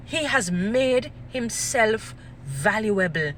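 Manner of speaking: very slow, word by word; slow emphatic repeat. Real, not acted. Speaker driving the point home, slow and emphatic. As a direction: spell out "He has made himself valuable" in separated, weighty chunks.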